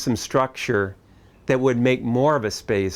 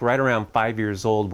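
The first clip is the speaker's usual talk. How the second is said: ordinary talk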